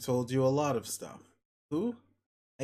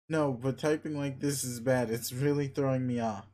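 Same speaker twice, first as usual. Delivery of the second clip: normal talking voice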